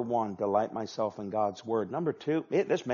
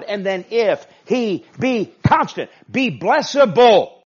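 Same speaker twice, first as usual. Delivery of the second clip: loud, higher, hammering the point home; preacher building to a loud, higher climax; preaching at full force; 8 semitones higher, 10 dB louder than usual. Acted. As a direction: drive it home from the pulpit.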